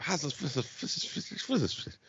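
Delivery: soft, whispery trailing gibberish; mumbling impression. Mocking a president losing his thread, hushed mumbling impression. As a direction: let the president impression dissolve into hushed mush.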